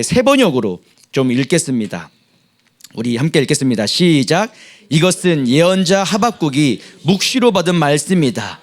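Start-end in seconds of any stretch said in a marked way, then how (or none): 4.9-8.6 loud, higher group recitation; scripture reading. Acted. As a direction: read the lament out boldly. Reads scripture with congregation. (힘 있게 함께 성경 낭독)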